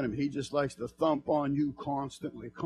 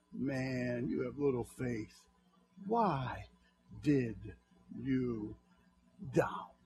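1 soft slow rebuke; 4 semitones lower, 9 dB quieter, much slower than usual. Acted. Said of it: "he cried, saying".

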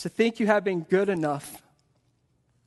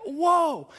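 high, awed whoa; imitated excitement; 9 semitones higher than usual. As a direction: act out people overwhelmed with excitement. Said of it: "They're so excited to have these Bibles".

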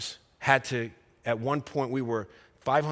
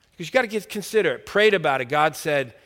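ordinary talk, nothing marked

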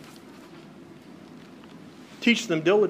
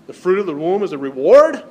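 reward asked as a doubtful question, louder; incredulous question; 7 dB louder than usual. Shown as a demonstration. Pastor mimics modern doubt to the congregation, incredulous. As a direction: voice the culture's disbelief. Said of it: "I don't think we get that in our culture today".

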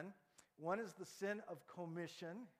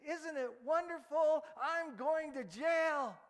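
much higher and louder, slow and elated; joyful boast; 11 semitones higher, 10 dB louder, slower than usual. Acted. George overjoyed telling the town he's going to jail. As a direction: crow with delight about the jail. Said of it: "he boasts this way".

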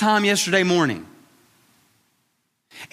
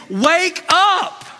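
urgent call; 10 semitones higher, 3 dB louder than usual. Retold or imitated. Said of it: "he's saying".

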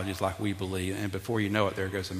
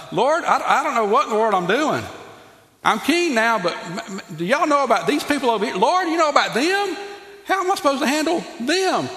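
put-on higher, louder, a pleading young king; young Solomon pleading; 13 semitones higher, 6 dB louder than usual. Pastor voices Solomon crying out to God, higher and louder. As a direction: beg the Lord like a lost new king. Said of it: "And he's like".

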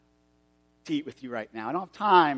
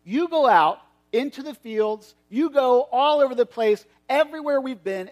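higher and louder, commanding; angry command; 7 semitones higher, 5 dB louder than usual. Acted. Master angrily sending the servant into the fields. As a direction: order the servant out, fired up. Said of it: "he gets kinda angry".

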